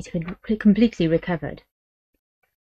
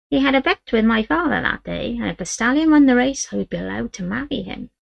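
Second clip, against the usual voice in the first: put-on higher, misty-eyed old woman's voice; nostalgic old woman; 5 semitones higher than usual. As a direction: she recalls her wild youth. Great-grandmother voiced.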